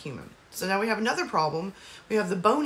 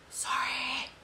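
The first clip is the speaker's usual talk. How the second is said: whisper; 6 semitones higher, 5 dB quieter than usual. Real, not acted. Apologizing for the noisy cat.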